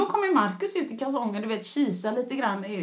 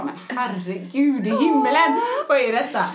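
bewildered husband. Retold or imitated. Husband baffled at scene.

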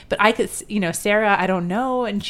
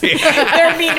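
laughing; 9 semitones higher, 9 dB louder than usual. Real, not acted. Laughing at mean fans.

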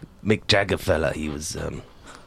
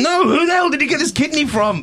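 angry yell; 4 dB louder than usual. Acted. Patient outraged at the doctor.

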